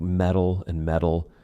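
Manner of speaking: METAL with a quick D, like "medal"; flap T. Shown as a demonstration. Teacher showing homophones, METAL with flap T. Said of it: "flap T or quick D".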